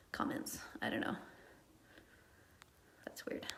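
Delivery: hushed, to herself, about the comments; puzzled whispered aside. Real, not acted. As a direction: drop to a whisper, half to yourself. Muttering aside about missing comments.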